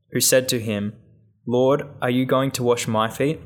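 ordinary talk

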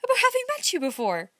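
put-on a girl teasing Dick, pitched high; laughing young woman. A narrator's reading. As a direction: toss it off with a laugh in the voice. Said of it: "she laughed".

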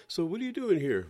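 high, loud reporter question; mock reporter, overeager. Mock reporter interviewing man.

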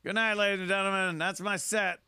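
a hammed-up stand-up wrapping the set, partly fast; mock sign-off. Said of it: "And he goes".

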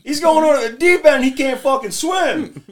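high, fast, loud alarm; alarmed kid voices. Retold or imitated. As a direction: panic at a friend in danger. Friends alarmed in retold scene.

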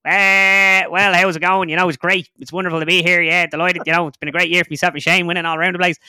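high, loud Irish golfer impression; Padraig Harrington. Comedian contrasts accents an hour apart, high loud golfer impression. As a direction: push it up high and loud, delighted Irish sportsman. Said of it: "you have Padraig and it's like".

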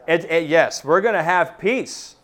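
ordinary delivery

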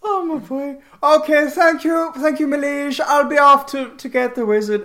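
high, loud, hearty character voice; Cassidy, hearty. Acted. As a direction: take a breezy leave in a big high voice. Cassidy takes leave of Apollo.

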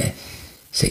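ordinary talk, nothing marked

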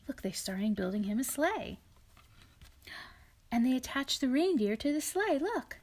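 ordinary delivery

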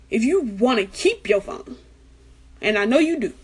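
usual speaking voice

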